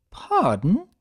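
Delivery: high refined surprise; Rarity voice. Acted. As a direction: play a fashion mare caught off guard.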